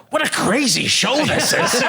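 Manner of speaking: put-on slow, high, drawn-out old man voice; Mel Brooks impression. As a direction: stretch it out high and slow like a bemused old star. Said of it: "And Mel Brooks just goes".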